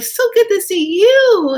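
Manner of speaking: high, loud, drawn-out delight; retold happy squeal; gushing greeting. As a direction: stretch out a thrilled hello. Acting out an excited reunion greeting.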